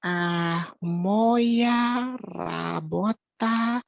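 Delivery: ordinary talk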